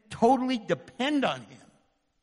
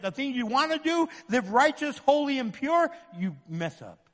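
ordinary talk, nothing marked

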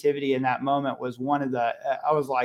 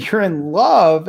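louder, higher, drawn-out disbelief; incredulous echo; 5 semitones higher, 7 dB louder, slower than usual. Retold or imitated. Dad answering his young son's confession, incredulous. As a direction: draw out love in mock astonishment.

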